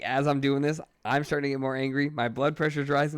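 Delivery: usual speaking voice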